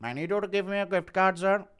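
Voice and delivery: high put-on caller voice; scam caller. Scammer asking fighter for gift card, put-on voice. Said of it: "getting a scam call from Ghibli".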